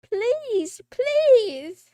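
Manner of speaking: loud, high, stretched-out begging; mock pleading. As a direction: beg dramatically at a high pitch. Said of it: "she's like".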